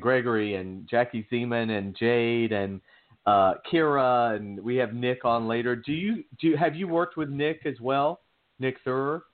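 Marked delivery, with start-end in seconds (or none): none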